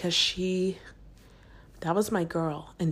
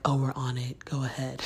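lower, matter-of-fact crew voice; audio guys; 5 semitones lower than usual. Retold or imitated. Imitating audio guys answering a contestant, lightly voiced low crew. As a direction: lightly put on a low crewman's voice. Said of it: "the audio guys were like".

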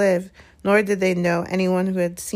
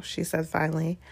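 plain reading voice

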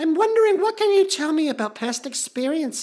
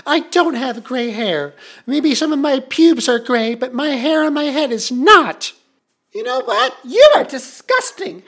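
furious rant; 4 dB louder than usual. Acted. Host to caller, loud furious tirade. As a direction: tear into her, louder at the end.

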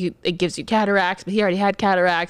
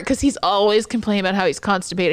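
ordinary delivery

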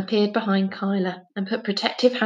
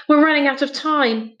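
higher, urgent outburst; bursting out; 6 semitones higher, 4 dB louder than usual. A narrator's reading. Finn to the children in the lantern room, bursting out. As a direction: blurt it out with sudden urgency. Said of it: "burst out Finn".

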